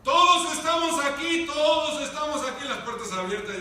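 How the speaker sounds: loud, high call to stay calm; shouting to calm. Acted. Paul shouting at the jailer to stay calm. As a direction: shout reassurance across the prison. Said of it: "le empieza a gritar el apóstol Pablo".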